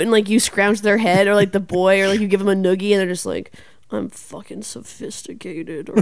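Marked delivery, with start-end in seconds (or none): 3.9-5.9 muttered posh-dog pride; snobbish dog mutter. Retold or imitated. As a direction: mumble it with wounded dignity.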